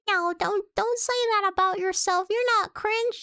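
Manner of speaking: mock pleading. Real, not acted. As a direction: rush in to reassure, pitching up. Reassuring chat, rising pitch.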